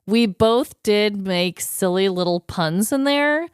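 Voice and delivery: ordinary delivery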